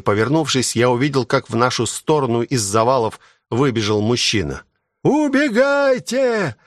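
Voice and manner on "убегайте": high, drawn-out distant-style cry; urgent high-pitched yell, long vowels; shouted warning. A narrator's reading. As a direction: call out slowly and high to make them run. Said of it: "кричал он, размахивая руками".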